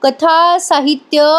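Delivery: slow, drawn-out words as if searching memory; slowly recalling. Retold or imitated. Aunt slowly recalling the book's name for Jackie, slow. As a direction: drag it out while trying to remember. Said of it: "हळूहळू आठवत सांगते".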